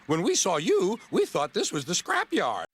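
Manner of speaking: giggling. Acted. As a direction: tease him with a high little giggle. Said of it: "giggled Ben".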